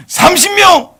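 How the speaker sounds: very high and loud; squealing bid. Acted. Abraham bidding thirty to God, very high. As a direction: blurt the number out cheekily.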